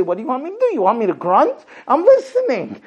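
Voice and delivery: husband protesting he is listening; mock defensive husband. A husband answers his wife, defensive and exasperated. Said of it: "You say".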